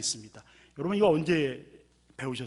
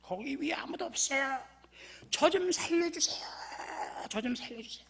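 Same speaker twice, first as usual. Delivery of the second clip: high, pleading calls for help; cry for help; 12 semitones higher than usual. Retold or imitated. Man hanging off a cliff crying for help, high and pleading. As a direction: call up the cliff desperately. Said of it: "도와달라고 소리를 치는 거예요".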